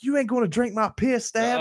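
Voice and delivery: high, loud goading yell; imitated hollered challenge; taunting dare. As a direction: egg a buddy on in front of a crowd. Donnie dares him before a crowd.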